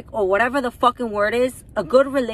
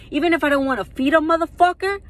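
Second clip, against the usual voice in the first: higher, louder, fast angry burst; angry outburst; 6 semitones higher, 4 dB louder, faster than usual. Real, not acted. Angry mother snapping at her ex over the documentary. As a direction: spit it out in real anger. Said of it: "I have a foul mouth when I'm mad".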